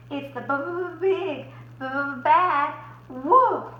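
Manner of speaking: slow, stuttering terrified pig voice; teeth chattering. Acted. Boris chattering with fear at the window. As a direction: stammer in terror. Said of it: "Boris chattered".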